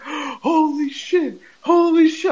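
high, slow stunned gasp of disbelief; shocked crowd. Retold or imitated. Texas crowd reacting in shock to a cuss word. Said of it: "the Texas crowd just went like".

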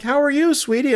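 high, sugary imitation of a friendly actress; sweet greeting. Actress hugging a starstruck fan, high sweet greeting.

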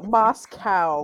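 "Moscow" ending loud and long on "cow"; heavy on the cow. Shown as a demonstration. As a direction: stress "Moscow" hard on the "cow" sound. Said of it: "heavy on the cow".

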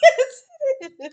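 high, loud, through laughter; laughing. Real, not acted. Insists while laughing.

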